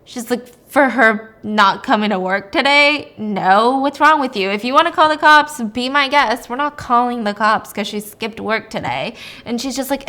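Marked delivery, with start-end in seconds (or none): 0.7-9.1 loud, incredulous put-down; loud, exasperated dismissal; scornful scolding. Retold or imitated. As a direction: tell them off like they're being ridiculous. Old nurse scolding the doctor, acted.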